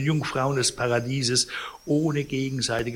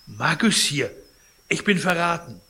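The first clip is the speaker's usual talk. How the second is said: a touch of alarm, raised pitch; alarmed outcry; 5 semitones higher, 5 dB louder than usual. A narrator's reading. Sick man finds intruders by his bed, mild alarmed outcry. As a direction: cry out at a betrayal, mildly.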